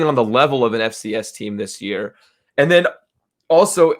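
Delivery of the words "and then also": talking through hiccups. Real, not acted. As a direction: hiccup interrupting the words. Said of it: "I got the hiccups all of a sudden".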